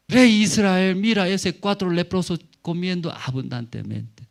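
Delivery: high, excited announcement to a king; urgent report. Acted. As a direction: bring startling news to the king. Messenger reports news to the king.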